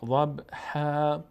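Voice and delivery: ضبحا ending in a long open -haa, no n; ضبحا with tanween turned to long a; pausal reading. Shown as a demonstration. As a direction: stretch the last vowel of ضبحا slowly.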